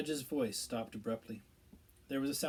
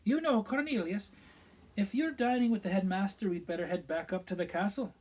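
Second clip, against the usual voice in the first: a higher, louder stern teacher voice; Professor McGonagall; 7 semitones higher, 8 dB louder than usual. A narrator's reading. Professor recalling a former student, stern and regretful.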